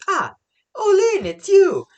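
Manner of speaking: high, loud squeal of recognition; excited recognition. Retold or imitated. Tama spotting Olin, excited high cry. Tama's delighted recognition acted out.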